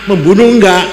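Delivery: ordinary talk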